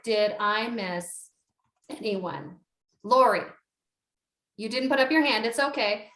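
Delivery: usual speaking voice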